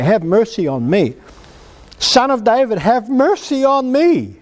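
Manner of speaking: climbing cry for mercy; drawn-out calling out to the Lord; shouting out. Retold or imitated. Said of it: "shout out to the Lord".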